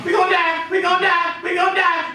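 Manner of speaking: high, frantic chant of doom; panicking type. Acted. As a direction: wail it over and over like someone panicking. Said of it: "You got the panic in type".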